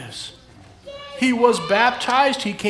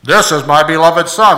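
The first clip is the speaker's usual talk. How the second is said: much louder, low booming voice of God from heaven; big voice; 5 semitones lower, 12 dB louder than usual. Shown as a demonstration. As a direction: boom it out like God from the sky. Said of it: "Oh, that worked".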